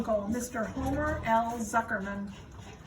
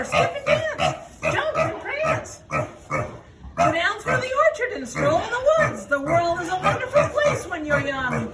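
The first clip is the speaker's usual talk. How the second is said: much higher and louder, gleeful goose; excited goose; 8 semitones higher, 5 dB louder than usual. Acted. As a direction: cheer the pig out into the world. Goose urges Wilbur.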